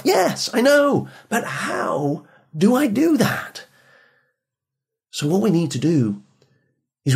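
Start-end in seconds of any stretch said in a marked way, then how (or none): 0.0-3.7 much higher, slow, whiny retort; exasperated teen reply. Retold or imitated. Son answering his nagging parents at the door, slow mock whine. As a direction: drag it out like a fed-up teenager.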